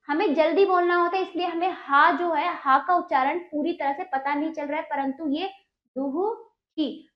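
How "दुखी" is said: दुखी said slowly with a light ह between द and खी, du-hu-khi; slow drawn-out dukhi. Shown as a demonstration. Teacher shows pupils the hidden ह sound in दुखी, slow and clear. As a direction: stretch दुखी so the soft ह sound is heard in the middle. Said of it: "हल्का सा इसमें हा का बीच में".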